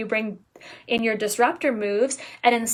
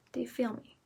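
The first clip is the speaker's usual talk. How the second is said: whispering; 12 dB quieter, faster than usual. Real, not acted. Repeated softer.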